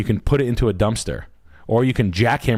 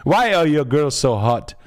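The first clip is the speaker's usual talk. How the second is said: put-on lightly done Nigerian accent, drawn out and slow; a hint of a Nigerian priest marvelling at Australians; Nigerian teacher impression; slower than usual. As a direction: play his old Nigerian teacher, amused and exasperated. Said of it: "Mister Obadiah was his name".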